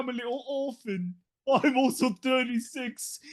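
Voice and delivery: hammed-up Victorian waif; Dickensian urchin. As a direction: play a pitiful Dickens orphan. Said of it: "Are you a Charles Dickens character?".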